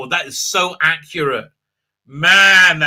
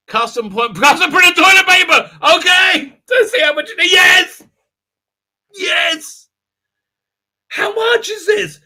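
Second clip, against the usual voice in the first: much higher, louder thrilled yells; excited shouting; 13 semitones higher, 7 dB louder than usual. Real, not acted. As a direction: shout it out, barely containing glee. Thrilled at custom toilet paper.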